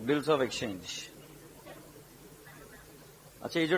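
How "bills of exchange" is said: slow, softer, drawn-out dictation. Real, not acted. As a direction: read the heading out slowly for note-takers. Dictating the heading for writing. (धीरे-धीरे लिखवाते हुए)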